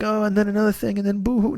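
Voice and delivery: mocking sad-sack whine; mock whining. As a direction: mimic a complainer going boo-hoo. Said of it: "you don't wanna hear me sit here and be like".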